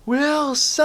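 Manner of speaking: high, drawn-out mock yell; demonstrated yell. Shown as a demonstration. Voice actor shows yelling for a best friend, high controlled yell. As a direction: fake a long cry for a lost friend. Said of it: "like, you need to yell".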